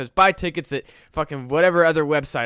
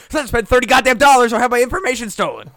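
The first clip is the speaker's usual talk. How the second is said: higher, louder, faster furious finish; rant climax; 7 semitones higher, 7 dB louder, faster than usual. Real, not acted. To Jeff, finishing the ticket rant: furious fast outburst. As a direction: explode at the end of the rant. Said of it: "Rant fucking over".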